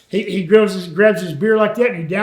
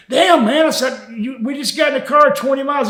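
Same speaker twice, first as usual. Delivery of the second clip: annoyed; 6 semitones higher than usual. Retold or imitated. Annoyed at Scott's pee request.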